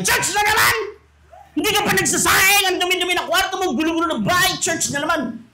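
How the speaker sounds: nagging mother. Retold or imitated. Mother complaining about church.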